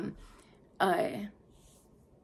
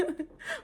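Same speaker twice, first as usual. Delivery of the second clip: high giggle; 11 dB quieter than usual. Real, not acted. Laughing at her own story.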